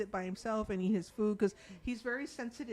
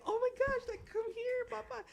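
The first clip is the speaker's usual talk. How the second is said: high, excited squeal of reunion; much higher, eager mother's welcome; gushing mom greeting. Retold or imitated. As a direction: call him over like you haven't seen him in years. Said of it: "and you're like".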